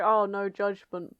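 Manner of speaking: ordinary talk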